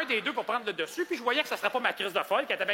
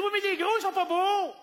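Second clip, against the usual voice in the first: whiny lady; 10 semitones higher than usual. Retold or imitated. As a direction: whine it like a fussy shopper. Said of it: "Elle dit". (cliente difficile qui se plaint d'une voix aiguë)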